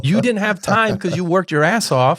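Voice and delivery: high-pitched exasperated comeback; comic incredulous rebuttal; indignant retort. Retold or imitated. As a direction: push back at a father, pitch soaring. Arguing back at father.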